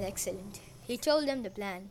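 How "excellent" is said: evil genius. Retold or imitated. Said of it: "an evil genius ever said".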